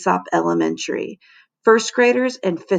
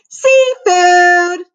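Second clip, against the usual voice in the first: put-on loud, very high kid squeal; shout; 11 semitones higher, 7 dB louder than usual. A narrator's reading. Kid showing off earlier at lunch, shouting.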